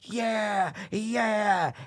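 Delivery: high mock crowd cheer; imitating an excited crowd cheering. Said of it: "the fucking crowd is like".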